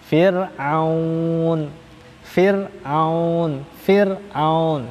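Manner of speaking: "Fir'aun" with a light thin r, not thick. Shown as a demonstration. Teacher demonstrating the correct thin Fir'aun r. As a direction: repeat "Fir'aun" keeping the r thin. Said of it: "Tipis, Fir'aun".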